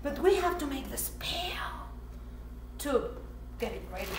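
hushed spell-casting whisper; secret whisper. Pretend. Child whispering the spell to the viewers.

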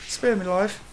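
high-pitched English beggar's plea; English accent. Acted. Player begs for his life, mock English accent. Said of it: "not too bad of an English accent".

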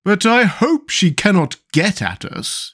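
high, slow worried pumpkin man; mildly frightened character voice; fearful exclamation. A narrator's reading. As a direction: exclaim it high, with a shiver of fear. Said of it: "exclaimed the Pumpkinhead with a shiver of fear".

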